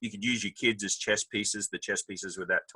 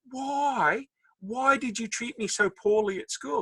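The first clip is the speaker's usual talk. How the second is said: high, plaintive question; his younger self; 13 semitones higher, slower than usual. Retold or imitated. Asking the mean girls why, high and hurt. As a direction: ask it like a hurt kid. Confronting former bullies, voiced.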